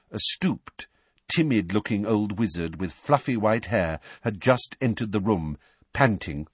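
usual speaking voice

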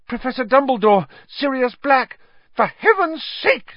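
high, loud, frantic outburst; hysterical. A narrator's reading. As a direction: cry out in high-pitched hysteria. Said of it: "said Madam Pumphrey hysterically".

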